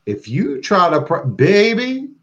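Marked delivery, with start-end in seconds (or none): none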